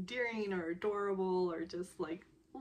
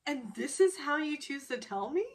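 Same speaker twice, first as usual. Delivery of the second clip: acted-out disbelief, higher and louder; incredulous retort; 7 semitones higher, 5 dB louder than usual. Woman reacting to a blurted confession, incredulous. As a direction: throw it back as an indignant question.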